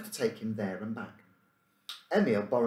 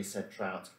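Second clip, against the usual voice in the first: ordinary delivery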